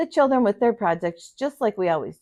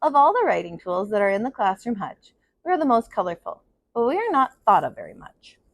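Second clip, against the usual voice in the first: put-on steady storyteller reading to children; story narration; 2 semitones higher than usual. A narrator's reading. Teacher reading a picture book to her class, in a gentle storytelling voice. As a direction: read it out like circle time for kids.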